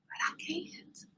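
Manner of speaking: hushed, deflated confession; whispered admission. Real, not acted. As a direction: drop to a whisper as the bravado collapses. Admits defeat after boasting.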